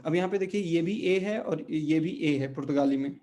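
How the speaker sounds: ordinary talk, nothing marked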